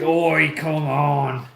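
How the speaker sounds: hammed-up Irish accent that lands like a pirate; mock Irish accent. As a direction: put on a bad Irish accent. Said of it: "You sound like a pirate".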